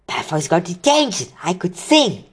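high, gleeful voice; excited. Acted. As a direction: bubble over with glee. Hermione celebrates, does jig.

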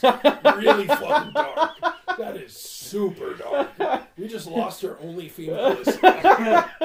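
slow, loud laughing protest. Real, not acted. Podcast host reacting to a dark joke, laughing protest. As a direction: protest while cracking up. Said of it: "too dark".

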